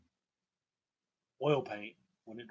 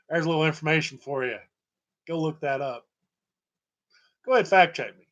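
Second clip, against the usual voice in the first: loud; 3 semitones higher, 8 dB louder, faster than usual. Real, not acted. Daring viewers to fact check.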